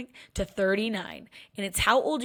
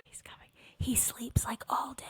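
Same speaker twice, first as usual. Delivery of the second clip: whispered aside; 8 dB quieter than usual. Real, not acted. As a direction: let it slip under your breath. Aside about sleepy dog.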